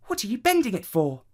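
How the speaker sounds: put-on high, quick child's question; high-pitched, hurried query; puzzled child. A narrator's reading. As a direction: pipe up fast as a puzzled niece. Child questions uncle in story.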